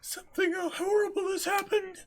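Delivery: an alarmed cry, pitched high; high alarmed shout. Acted. Reenacted scene, alarmed.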